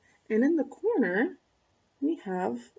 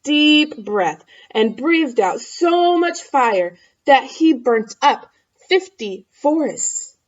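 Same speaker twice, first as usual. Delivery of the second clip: dramatic narration; 3 semitones higher, 6 dB louder, slower than usual. A narrator's reading. Dramatic fire-breathing narration.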